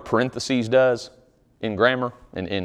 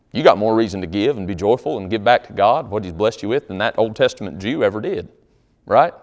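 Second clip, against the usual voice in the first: ordinary talk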